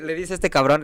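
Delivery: usual speaking voice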